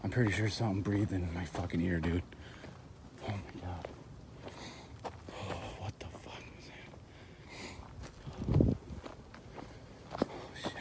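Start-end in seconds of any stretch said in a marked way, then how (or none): 3.3-10.7 breathless scared whisper; whisper, terrified of the noise; frightened whisper. Real, not acted.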